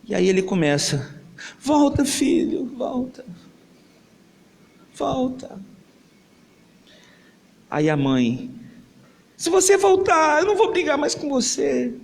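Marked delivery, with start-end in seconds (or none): 1.6-5.6 grieving plea. Acted. Father holding his dead son and begging him to return, fading. 9.4-11.9 much higher, pleading; mother's tearful plea. Acted. Mother begs her dead son, pleading in a high voice.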